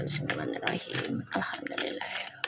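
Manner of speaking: hushed murmured prayer before the reading; whispered prayer. Real, not acted. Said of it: "kita baca doa ya".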